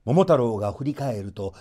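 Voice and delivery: usual speaking voice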